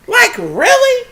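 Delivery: high, slow drawn-out really; incredulous really. Real, not acted. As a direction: sound like you can't believe it. Disbelief at host.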